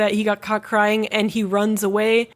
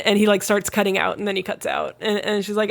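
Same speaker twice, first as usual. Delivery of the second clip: usual speaking voice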